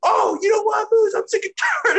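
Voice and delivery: squeaky parody of a pompous boss; mocking impression. Mocking the boss to a friend, shrill mock promo. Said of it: "And he's just like".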